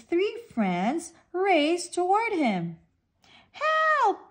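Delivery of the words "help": high, frantic shout; little fish fleeing the shark, panicked; panicked cry for help. A narrator's reading. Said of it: "cries Sprat".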